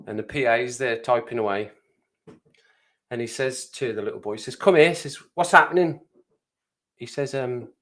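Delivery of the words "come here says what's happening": calling over. Acted. PA calls the boy over.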